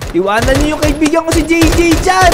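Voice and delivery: loud in-game warning; protective shout. Acted. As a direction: call out loudly in a friend's defence. Yelling at zombies to leave friend.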